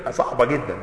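ordinary talk

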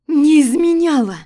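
wife screaming denial; breaking scream. Acted. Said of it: "Криком голос срывается".

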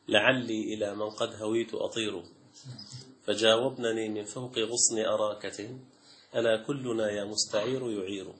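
poem recitation. Shown as a demonstration. Teacher reciting an old love poem about the sandgrouse, softly.

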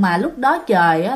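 ordinary delivery, nothing marked

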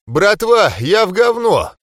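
panting, high and loud; out of breath. Acted. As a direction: gasp it out, winded. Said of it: "пропыхтел Джексон".

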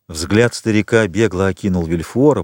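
ordinary delivery, nothing marked

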